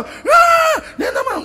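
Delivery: put-on high wailing toddler; whiny child. Little daughter wailing back at the order, high and loud. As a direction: wail like a fussy little girl.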